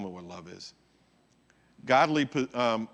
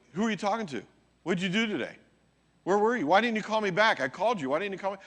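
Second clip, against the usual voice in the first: higher, pestering interrogation; suspicious spouse; 7 semitones higher, 4 dB louder, faster than usual. Retold or imitated. Possessive spouse grilling their partner, nagging interrogation. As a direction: fire off suspicious questions. Acting out jealous spouse questioning.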